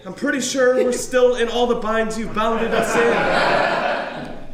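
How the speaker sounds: high, loud and exasperated. Acted. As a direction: snap back, exasperated and laughing. Exasperated friend arguing back.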